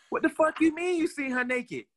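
indignant outburst. Retold or imitated. To Antonio, who has seen her naked, a mild mock outrage. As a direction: fire it back, offended. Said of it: "I said".

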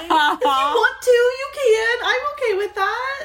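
high, squealing mock offer; giddy high squeal; swooning squeal. Real, not acted. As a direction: swoon at the line.

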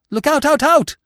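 high, loud cry of warning; alarmed bird cry. A narrator's reading. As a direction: shriek it like a frightened jay. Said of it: "would cry".

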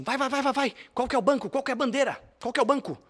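high, loud mock robber yelling demands; hammed-up robber barking for the bank; imitating the robber. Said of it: "E ele falava assim".

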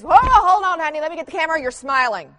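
excited dad. Retold or imitated. Husband quoted as excited dad.

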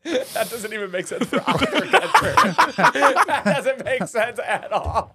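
high laughing disbelief; laughing it off, slowed and high; laughing protest. Real, not acted.

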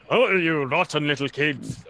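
hammed-up pantomime baddie, starting loud; stagey mock scolding; pantomime villain. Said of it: "because it's pantomime, it's like you can".

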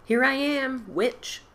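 put-on loud, high boyish cry; gleeful shout. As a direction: shout it with glee. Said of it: "hilarious inside his bones".

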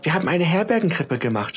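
put-on high, bright child's pride; a proud little girl beaming; beaming, cheerful. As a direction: beam with joy at your work. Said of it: "strahlte Julia fröhlich".

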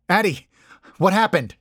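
high, loud, urgent asking; alarmed question. A narrator's reading. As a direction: urgently check on a crying friend. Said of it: "I asked".

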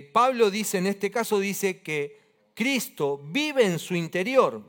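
loud, forceful sermon delivery; emphatic preaching. Real, not acted. Preacher urging believers to live out Christ, emphatic preaching. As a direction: drive each phrase home to the congregation.

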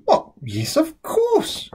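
Lola delighted. A narrator's reading. Sister proudly naming moon squirters her favourites, high and slow. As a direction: savour the words proudly.

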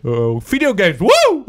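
high, loud gleeful whoop; shrieked catchphrase cheer, very high and loud. Acted. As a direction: whoop it up like a hype man. Podcast catchphrase cheer repeated.

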